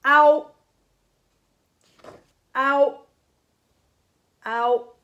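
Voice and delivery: Ao said loud and high, clean vowel with no H; Japanese model word. Shown as a demonstration. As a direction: announce Ao loudly for the class to repeat. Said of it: "comme je vous ai expliqué".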